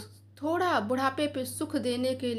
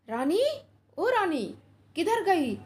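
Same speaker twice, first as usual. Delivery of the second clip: calling out; 5 semitones higher than usual. Acted. Son angrily calls for his wife across the house, raised. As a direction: shout her name down the hall.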